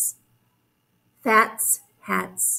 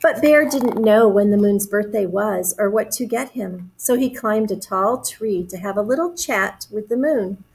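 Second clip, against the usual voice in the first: usual speaking voice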